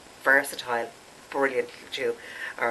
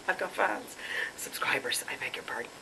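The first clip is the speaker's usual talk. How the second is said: whisper; 6 dB quieter than usual. Real, not acted. Self-mocking aside about fans.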